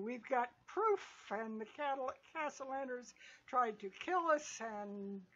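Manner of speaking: ordinary delivery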